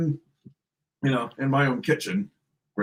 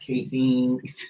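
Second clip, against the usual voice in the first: thin reedy voice; 4 dB quieter, slower than usual. Retold or imitated. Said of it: "put on this weedy little voice".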